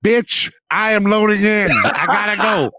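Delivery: shrill-pitched squeaky quote voice; high put-on voice. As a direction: pitch way up for the phone line. Wife's call, voiced line.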